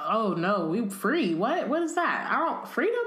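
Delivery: rising into a high, baffled mock elf voice; mocking house elf. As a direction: imitate a confused elf who never heard of freedom. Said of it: "you get all the house elves like".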